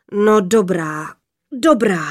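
slow, grudging, mighty father; sighing giant voice. A narrator's reading. Sky father giving in to his daughters, sighing heavily. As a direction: give in with a big, drawn-out rumble. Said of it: "vzdychl hrozitánský hlas".

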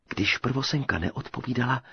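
plain reading voice

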